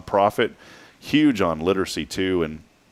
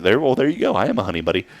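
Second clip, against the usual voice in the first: ordinary talk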